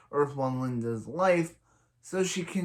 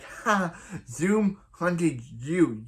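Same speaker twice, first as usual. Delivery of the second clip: higher, slow, hammed-up taunt; scoff, then drag out the jab; mock scoff; 6 semitones higher, 5 dB louder, much slower than usual. Retelling Harry taunting Garrick, mock scoffing.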